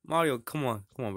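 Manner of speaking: usual speaking voice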